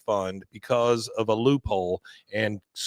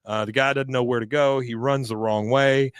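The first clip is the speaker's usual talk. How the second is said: ordinary talk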